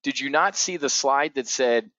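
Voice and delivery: ordinary talk, nothing marked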